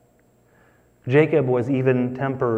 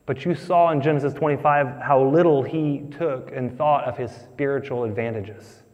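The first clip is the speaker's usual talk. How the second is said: ordinary talk, nothing marked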